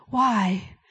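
ordinary delivery

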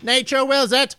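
game character voice. Pretend. Quoting game catchphrase.

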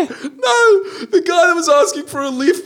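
high, loud squeal through laughter; cracking up, voice shooting way up; laughing correction. Real, not acted. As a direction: protest it helplessly amused and high-pitched. Corrects co-host while cracking up.

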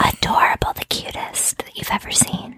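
soft breathy whisper. Real, not acted. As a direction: whisper it playfully. Whispered cute remark.

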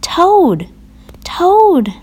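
high call, falling at the end; crying out. A narrator's reading. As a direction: cry the name out, high and loud. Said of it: "cried Frog".

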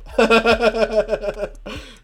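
loud, high laughing fit; laughing hard. Real, not acted. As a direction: crack up at your own story. Laughs at his story.

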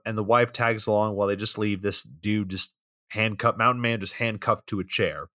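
ordinary delivery

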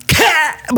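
high sudden "Kä!" like a knife going in; sharp stab cry. Retold or imitated. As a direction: yelp the stab sound out high. Knife stabbing into groin. (ett högt, plötsligt "Kä!" som ett knivhugg)

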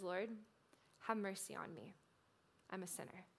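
soft, fading plea for mercy; bow your head and ask quietly; humble quiet prayer. Retold or imitated. The tax collector pleading before God, hushed and humble. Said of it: "He came quietly before God".

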